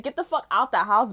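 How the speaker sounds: normal talking voice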